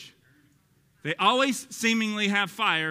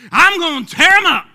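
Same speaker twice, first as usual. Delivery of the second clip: put-on loud, high Texas-accent holler; 11 semitones higher, 15 dB louder than usual. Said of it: "I just give it a little Texas accent".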